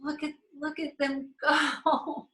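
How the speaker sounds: moved almost to tears, drawn out; tearful joy. Real, not acted. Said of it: "And I'm just like".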